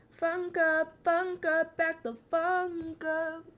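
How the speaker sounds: high, sing-song silly voice; high goofy back-off chant; goofy warning. Pretend. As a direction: playfully shoo them away in a funny voice.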